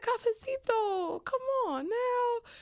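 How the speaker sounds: very high, slow, whiny squeal of joy; delighted squeal. Retold or imitated. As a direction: stretch it out like a thrilled, teasing whine. Squealing after he kissed back.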